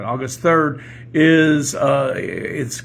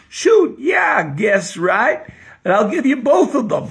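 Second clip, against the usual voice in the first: put-on high, shouted Kentucky joke accent; Southern accent; 10 semitones higher, 5 dB louder than usual. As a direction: holler back in exaggerated Southern speech. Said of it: "I'm gonna have to talk like this".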